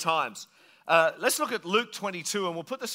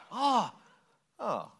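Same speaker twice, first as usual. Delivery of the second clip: mock mixed emotions; 5 semitones higher than usual. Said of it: "mixed emotions".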